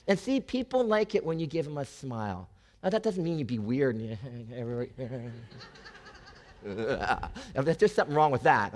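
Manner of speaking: normal talking voice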